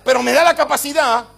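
full-force preaching. Acted. Preacher telling the church the Lord gives them capacity, full-force preaching. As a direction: build to a shouted climax, hammering each repeated phrase.

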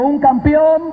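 high, slow, stretched-out declaration; preacher leading a call-and-response; rallying declaration. Real, not acted. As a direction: proclaim it for a crowd to repeat. Crowd repeats after preacher.

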